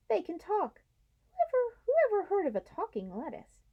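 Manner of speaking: high, amazed squeak; can't believe the lettuce talks; astonished mole. Acted. Mole astonished that the humans speak, high and amazed.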